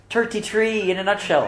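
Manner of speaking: put-on jokey Irish accent through a laugh. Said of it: "Do it in an Irish accent".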